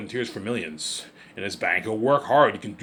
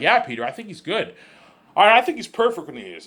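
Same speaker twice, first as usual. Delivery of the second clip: raised, high-pitched answer in an acted exchange; imagined reply; 9 semitones higher, 6 dB louder than usual. Replying to the director about his casting pick, high and insistent. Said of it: "I'm like".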